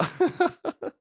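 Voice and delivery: a sudden high burst of laughter; high cackling laugh. Real, not acted. Comedian cracking himself up with a high cackle. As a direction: cackle with delight at the joke. Comic laughing at own joke.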